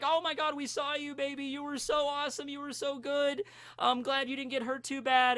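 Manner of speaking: high-pitched, gushing parental praise; supportive parents. Acted. Parents praise Uraraka on the phone, gushing support. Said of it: "they were very supportive".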